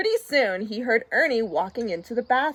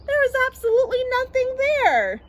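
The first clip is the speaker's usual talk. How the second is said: high exclamation; 4 dB louder, faster than usual. A narrator's reading. Bert finds nothing on his arm, high squawk. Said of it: "laughed Bert".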